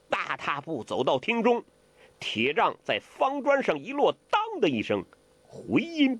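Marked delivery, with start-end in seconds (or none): none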